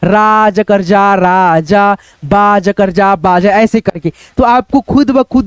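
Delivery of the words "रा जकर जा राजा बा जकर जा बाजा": syllable by syllable, very slow and stumbling; slow, broken reading of Raja and बाजा; slow halting reading. Shown as a demonstration. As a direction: sound it out haltingly like an embarrassed beginner. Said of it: "तो आप ऐसे अगर पढ़ते हो उनके सामने".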